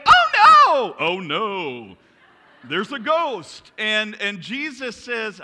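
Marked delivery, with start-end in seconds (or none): 0.0-1.1 very high, loud cry of alarm; shrieking like panicked disciples; mock scream. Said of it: "They scream and they say". 1.1-2.0 loud cry at normal pitch; mock manly cry. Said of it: "They scream and they say". 2.6-3.7 loud, higher, drawn-out cry; hammed-up ghost sighting; mock alarm. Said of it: "They scream and they say".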